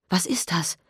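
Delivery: hushed alarm; horrified question. Acted. Mother asking the officer, hushed alarm. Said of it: "fragte entsetzt".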